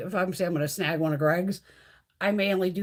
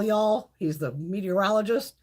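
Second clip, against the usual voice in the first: usual speaking voice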